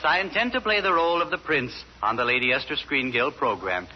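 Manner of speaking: ordinary talk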